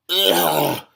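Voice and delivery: loud, high groan of disgust. Real, not acted. Reader groaning in disgust at the book. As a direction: let out a pained ugh.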